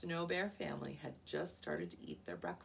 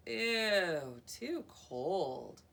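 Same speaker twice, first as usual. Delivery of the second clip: disgusted; 6 dB louder, much slower than usual. Acted. Aluki tastes cold soup.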